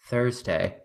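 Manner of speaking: soft low Thursday, trailing off; lazy model-style Thursday; light Patsy impression; Patsy bit. Shown as a demonstration. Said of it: "was Patsy when she was a model".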